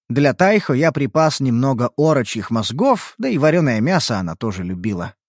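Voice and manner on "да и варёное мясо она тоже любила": ordinary delivery, nothing marked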